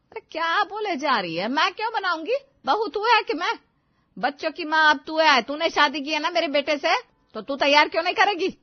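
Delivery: high, loud indignant scolding; angry scolding. Acted. Mother-in-law yelling at the lazy bride, angry. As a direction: scold her, rising into a yell.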